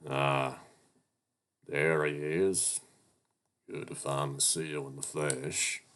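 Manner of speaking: unhurried, relaxed manager greeting; calm boss. Acted. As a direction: greet the new hire coolly from behind the desk. Voices the boss Chester.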